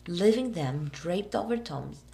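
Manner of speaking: ordinary delivery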